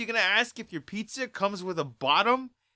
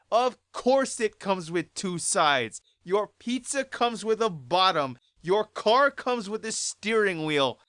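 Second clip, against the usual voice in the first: ordinary talk, nothing marked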